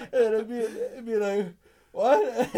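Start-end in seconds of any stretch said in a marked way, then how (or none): 1.9-2.5 loud, high mock shock; imitating a dumbfounded gamer; confused. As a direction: blurt it out, totally baffled. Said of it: "he got so confused".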